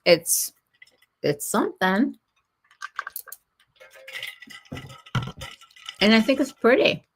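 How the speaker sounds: talking around an ice cube; words slurred by ice in mouth. Real, not acted. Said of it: "Got ice cube".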